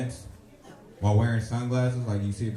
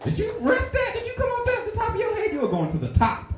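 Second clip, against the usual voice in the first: hyped inner voice. Retold or imitated. Inner voice hyping himself.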